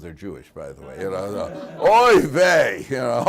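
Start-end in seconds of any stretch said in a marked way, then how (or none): 1.8-2.9 a drawn-out loud oy vey; loud oy vey groan. Retold or imitated. Congregant groaning at bad news with a loud oy vey. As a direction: moan it like a dismayed congregant.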